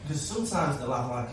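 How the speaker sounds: ordinary talk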